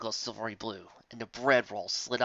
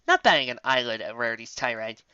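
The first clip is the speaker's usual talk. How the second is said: ordinary delivery, nothing marked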